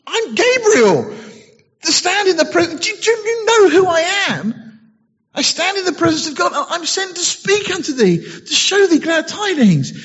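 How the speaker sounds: put-on high affronted angel reminding the old priest who he is; indignant Gabriel. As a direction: announce yourself, offended. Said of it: "the angel answering said unto him".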